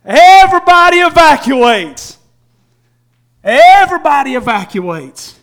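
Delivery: loud, high preaching cry; preaching at full force. Acted. Preacher crying out to the church, loud and high. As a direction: preach it hard to the congregation.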